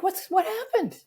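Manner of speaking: high-pitched startled parent, retold; alarmed question. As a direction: ask in sudden alarm. Startled parent asking sobbing daughter.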